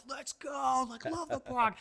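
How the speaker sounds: high, far-off mock cheer; imitating eager congregants; hyped quote. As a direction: squeal it like a fired-up churchgoer. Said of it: "People were like".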